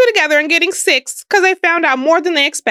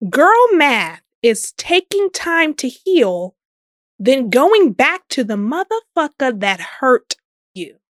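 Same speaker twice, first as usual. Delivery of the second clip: slow emphatic reading; slower than usual. Shown as a demonstration. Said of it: "Let me read that one more again for y'all".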